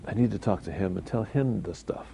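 the gentle words he really used; calm quiet retelling. Retold or imitated. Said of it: "I said".

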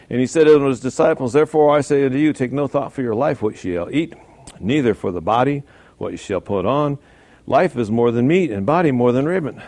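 loud declaiming Bible read; scripture reading. Acted. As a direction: proclaim the verse to the congregation. Reads scripture aloud.

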